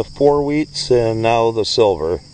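ordinary delivery

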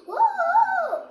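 high, loud startled cry with a laugh; startled whoa. Real, not acted. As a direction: yelp as your foot slips. Slipping on the path.

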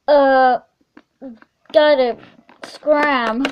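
usual speaking voice

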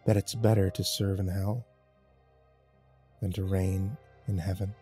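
low, soft, grating threat; hushed, deep menace; grated menace. A narrator's reading. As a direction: grate it out with grim pauses. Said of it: "I grated at her".

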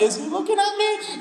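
very high-pitched imitation; mock flustered worshiper. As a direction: put on a high, self-conscious voice. Imagined worshiper distracted by crush.

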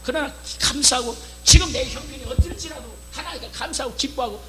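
normal talking voice

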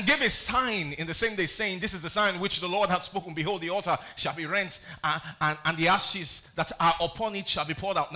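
loud proclaiming read; scripture reading. Acted. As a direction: declaim the prophecy. Reading prophecy aloud.

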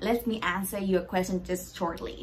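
ordinary delivery

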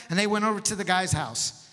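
usual speaking voice

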